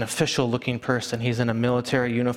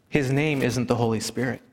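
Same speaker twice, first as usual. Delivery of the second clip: ordinary talk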